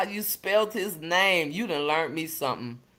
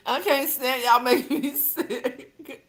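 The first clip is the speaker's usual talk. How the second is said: slow, higher, through laughter; laughing at the chat; 6 semitones higher than usual. Real, not acted. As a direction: drag it out cracking up.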